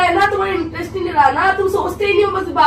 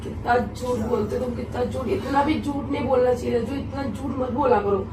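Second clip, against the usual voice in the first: quiet reproach; 6 semitones lower, 10 dB quieter than usual. Real, not acted. Quarrel, accusing him of lying. (धीमी, दबी आवाज़ में उलाहना)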